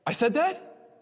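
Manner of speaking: high, rising incredulous question; acted-out astonishment; shocked disbelief. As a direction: imitate someone stunned by his own words. Friend confronted with his old remark.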